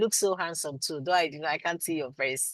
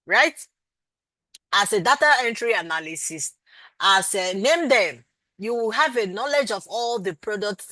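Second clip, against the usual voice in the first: raised high, loud scolding of a repeat question; exasperated; 4 semitones higher, 6 dB louder than usual. Real, not acted. Teacher to a student who asks about virtual, exasperated loud scolding. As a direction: scold her for asking again, voice up and loud.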